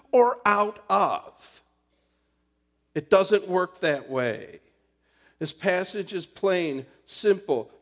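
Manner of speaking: loud, driving preaching; fervent preaching. Acted. Pastor preaching the love passage to the church, loud. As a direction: hammer each clause home to the congregation.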